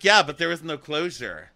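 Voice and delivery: high, loud whiny objection; mock protest.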